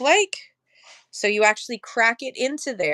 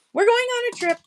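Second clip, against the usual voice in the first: sing-song announcement; 4 dB louder than usual. Pretend.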